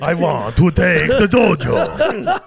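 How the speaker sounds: slow, loud, hammed-up vampire; drawn-out comic vampire proclamation. Co-host parodies the brother claiming the dojo, loud vampire voice. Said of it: "Why you sound like a vampire".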